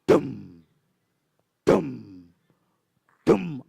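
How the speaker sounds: deep, slow stomping sound; lion footsteps. Shown as a demonstration. Showing children how the lion walks, deep stomps. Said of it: "Jalannya seperti ini".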